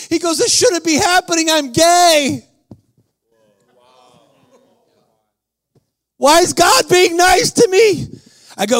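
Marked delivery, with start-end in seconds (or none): 0.4-8.1 high, straining outcry; distraught disbelief. Retold or imitated. Healed man who feels undeserving, acted high disbelief. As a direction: plead in stunned amazement, acted out.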